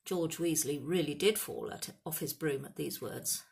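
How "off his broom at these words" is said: plain reading voice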